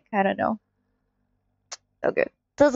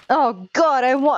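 ordinary talk, nothing marked

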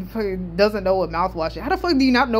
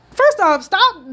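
loud outburst; 12 semitones higher, 8 dB louder, slower than usual. Real, not acted. Scolding chat about Anya.

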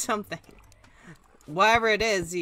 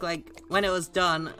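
ordinary delivery

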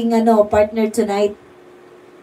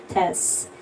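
soft unvoiced "Test."; whispered mic test; 4 semitones lower, 11 dB quieter than usual. Shown as a demonstration. Streamer testing her microphone, whispering "Test.". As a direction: breathe "Test." softly into the mic. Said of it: "Kung gumagana ba yung aking".

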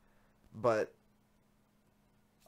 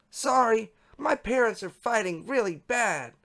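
higher-pitched reading voice for a chat participant; high chat-log voice; 13 semitones higher, 6 dB louder than usual. Acted. Reader voices a message about arguing parents, high voice. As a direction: read the message in a raised pitch.

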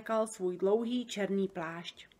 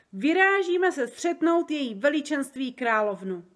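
loud, raised announcement; calling out; 4 semitones higher, 6 dB louder than usual. A narrator's reading. Giant announces the trip to the queen, calling out. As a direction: proclaim it with excitement. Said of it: "zvolal Obrdobr".

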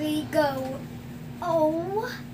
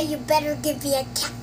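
normal talking voice

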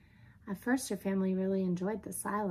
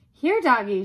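loud, higher sing-out to a dog; coaxing burglar; 5 semitones higher, 12 dB louder than usual. Acted. Burglar offers the dog food, coaxing. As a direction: lure the dog over.

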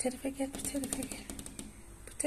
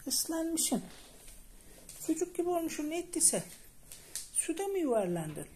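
surprised fussing; 8 semitones higher than usual. Real, not acted. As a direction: fuss over the wet cat. Islak kediye şaşkınlıkla sesleniyor. (ıslak kediye ince sesle şaşkın telaş)

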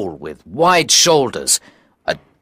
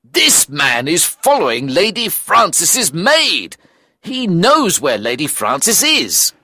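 pitched-up, insistent argument; heated protest; 8 semitones higher than usual. Acted. Watson arguing to Holmes about the stranger, insistent. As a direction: insist on the accusation.

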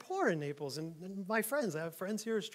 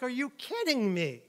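mock disbelief; 5 semitones higher than usual. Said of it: "I'm like".

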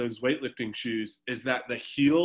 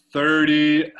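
called-out count; 2 semitones higher, 9 dB louder than usual. Real, not acted. Coach calls out the count.

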